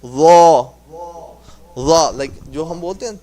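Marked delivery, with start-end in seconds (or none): 0.0-2.1 "Ra" as a drawn-out, rounded single syllable; practice demonstration. Shown as a demonstration. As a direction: model "Ra" alone with a full mouth. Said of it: "we're gonna practice that".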